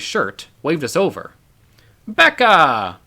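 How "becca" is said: louder Australian call across the room; friendly Australian man calling a name; Australian accent, calling out. A narrator's reading. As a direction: hail her warmly in a thick Australian accent. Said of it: "he called out in a thick Australian accent".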